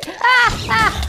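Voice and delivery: two high, loud yelps; startled scream. Real, not acted. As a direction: cry out in alarm, high and loud. Ghast fireball flies at the player.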